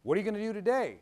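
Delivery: dad's demand. Retold or imitated. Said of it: "dad questioning son on phone".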